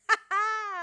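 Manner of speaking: high, loud burst of laughter; loud smug laugh. Retold or imitated.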